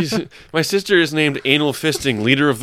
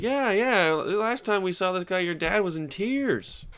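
higher, soft mom voice; imitating the mom; 6 semitones higher, 6 dB quieter, slower than usual. Mom telling her daughter about dad, imitating the mom. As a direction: lift it high and soft, slowing at the end. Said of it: "and she's like".

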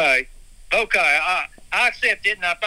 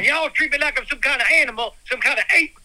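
aggrieved rant; 6 semitones higher, faster than usual. Acted. Guest ranting at the host about his grievances. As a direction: air every grievance hotly.

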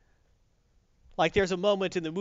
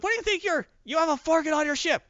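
much higher, incredulous demand; indignant Carol; 12 semitones higher, 4 dB louder than usual. Retold or imitated. Carol questions Rocket, high and incredulous. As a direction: demand an answer in disbelief. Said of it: "she's like".

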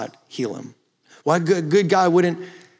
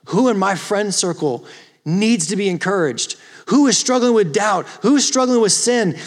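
raised, driving exhortation to the congregation; louder, higher, each question punched out; impassioned preaching; 5 semitones higher, 4 dB louder than usual. Acted. Preacher urging listeners to hold each other accountable, impassioned.

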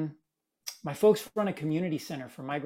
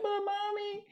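put-on much higher child's whine; whiny little kid; 3 dB quieter than usual. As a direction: play the small boy pleading. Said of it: "And I'm like".

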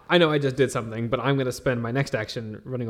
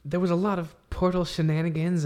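ordinary talk

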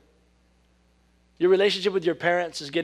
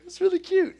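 much higher, squealing delight; excited; 6 dB louder than usual. Retold or imitated. Preacher mocking her own delight at a pun, high squeal. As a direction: squeal it like a thrilled kid. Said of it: "I get really excited about really dumb things".

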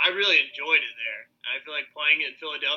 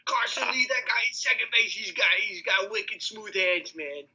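put-on higher Boston announcer accent; Boston sports guy voice; 5 semitones higher than usual. Continues the Boston bit.

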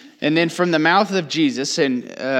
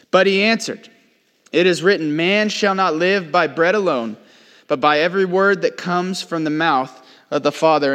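measured, louder reading of scripture; 3 semitones higher, 5 dB louder than usual. Acted. Preacher reading scripture to the congregation, measured and louder. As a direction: read the Bible verse out to the congregation. Reads scripture aloud in sermon.

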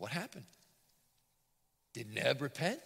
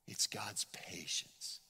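slow hushed whisper; a hushed reverent whisper; 5 semitones lower, 6 dB quieter, much slower than usual. Real, not acted. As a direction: breathe the words softly. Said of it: "whispered aside".

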